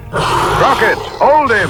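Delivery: high, loud urgent call; loud high battle cry; shout. Acted. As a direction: yell it across the fight. Calling during the struggle.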